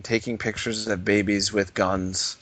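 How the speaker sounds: ordinary talk, nothing marked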